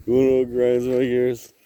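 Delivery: hammed-up TV rant line; catchphrase voice. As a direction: deliver it like a cartoon dad. Peter Griffin catchphrase redo.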